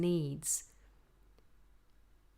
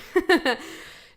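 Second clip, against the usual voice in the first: laughing; 11 semitones higher, 10 dB louder than usual. Real, not acted. Laughs at own impression.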